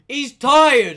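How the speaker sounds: loud, high mock announcement; calling out. Coworker telling three other people he is tired, calling out. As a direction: holler it across to the others.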